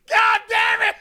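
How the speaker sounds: high-pitched imitated outburst of frustration; exploding teacher. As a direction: yell it like a teacher finally losing it. Teacher losing it, throwing podium.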